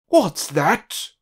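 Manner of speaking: loud rude roar. A narrator's reading. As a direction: bellow it out rudely, like a pompous snob. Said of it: "roared Mister Uppity".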